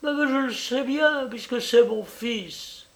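high, stammered protest; mother protesting for her son; insistent mother. A narrator's reading.

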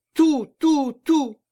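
high, loud, eager outbursts; exclaimed cry. A narrator's reading. Executioner promises the monk to tell all, high urgent cry. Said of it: "s'écria le bourreau".

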